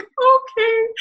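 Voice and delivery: embarrassed high-pitched okay; sheepish okay. Retold or imitated. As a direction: shrink back and squeak out your agreement. Sheepish reply after being told off.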